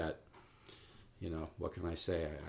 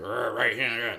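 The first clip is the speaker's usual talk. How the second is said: louder, growled show of too much effort; over-tense straining; 3 semitones higher, 8 dB louder than usual. Shown as a demonstration. Teacher showing students how not to focus, over-tense growl. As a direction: growl it out as if forcing the focus, exaggerated. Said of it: "No, not like that. Just very relaxed".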